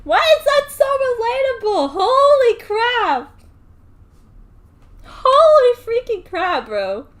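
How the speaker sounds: high, loud, slow exclaiming; excited shout. Real, not acted. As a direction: yell in disbelief. Streamer overwhelmed by relatable line.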